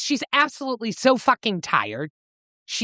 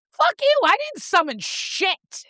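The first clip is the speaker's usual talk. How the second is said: high-pitched mock outrage; indignant retort; 11 semitones higher than usual. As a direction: act out a feisty, offended denial. Acting out her indignant retort.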